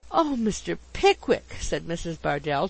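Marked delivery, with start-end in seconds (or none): 0.1-1.6 higher, mildly agitated exclamation; a touch of fluttery agitation; trembling agitation. A narrator's reading. Landlady answers Mister Pickwick's question, mildly agitated. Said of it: "trembling with agitation".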